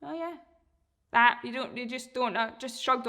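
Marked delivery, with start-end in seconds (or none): 0.0-0.3 unbothered dog. Pretend. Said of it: "they kind of just go".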